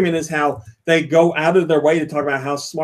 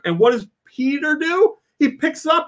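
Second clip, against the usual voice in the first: usual speaking voice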